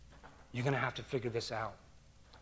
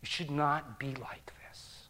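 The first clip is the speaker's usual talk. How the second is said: whisper; 6 dB quieter, slower than usual. Acted. Preacher drops to a whisper.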